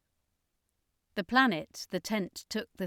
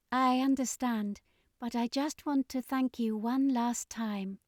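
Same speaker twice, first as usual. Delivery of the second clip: Askira's voice; 5 semitones higher than usual. A narrator's reading.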